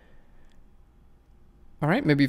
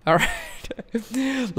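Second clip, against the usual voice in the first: laughing; 8 semitones higher than usual. Real, not acted. Laughs after the mock apology.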